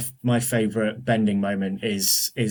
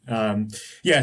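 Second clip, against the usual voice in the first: normal talking voice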